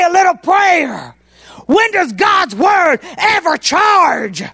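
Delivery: rising pulpit indignation; preaching. Acted. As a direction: drive the point home from the pulpit. Preaching against paid prayer.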